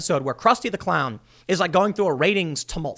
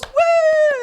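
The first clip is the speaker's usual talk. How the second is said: very high, loud imitated cheer; mock crowd whoop; 6 dB louder than usual. The studio audience cheering the host, a loud high whoop. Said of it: "everyone goes".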